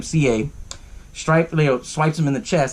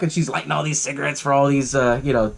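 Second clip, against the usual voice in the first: ordinary talk, nothing marked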